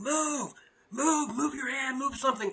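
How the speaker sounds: high, strained mock scream at no real volume; retold scream. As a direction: imitate screaming at your own body, held back. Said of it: "but I'm screaming".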